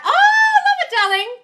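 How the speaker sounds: very high, loud delighted squeal; thrilled, high-pitched exclamation; gushing delight. Real, not acted. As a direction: gush at the compliment, voice shooting right up. Gushing thanks for a compliment.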